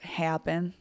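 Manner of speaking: "happen" with an exaggerated A; "happen", its first vowel oddly accented; weird accented A. Shown as a demonstration. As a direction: lean on the odd A in "happen". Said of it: "doing a weird accent on the, my A's".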